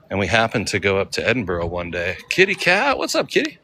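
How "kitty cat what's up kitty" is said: very high pet-talk voice; talking to a cat. Real, not acted. Streamer greets a kitty cat, high-pitched pet talk.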